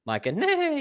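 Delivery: playful neigh imitation; mock neigh. Shown as a demonstration. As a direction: imitate a pretty horse neigh. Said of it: "he sounded like a T-Rex".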